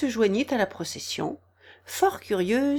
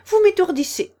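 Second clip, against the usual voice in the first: high, loud complaint; shouted; 10 semitones higher, 8 dB louder than usual. A narrator's reading. Queen irritated by bowing gardeners, shouting high. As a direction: cry out in exasperation. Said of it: "cria la reine".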